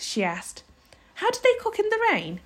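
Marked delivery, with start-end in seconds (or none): 1.1-2.4 high, quick worried question; high-pitched flustered protest; fretful questioning. A narrator's reading. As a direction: fuss anxiously, high and fast. Homily keeps fretting, interrupting Pod.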